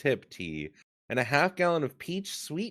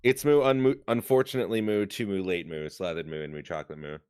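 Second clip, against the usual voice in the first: fading moo talk; 5 semitones lower, faster than usual. Pretend.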